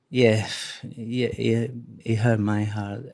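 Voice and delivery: normal talking voice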